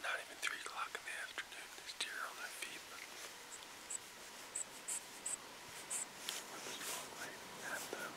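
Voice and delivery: hushed hunter's whisper. Real, not acted. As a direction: whisper so the deer won't hear. Hunter whispers in tree stand.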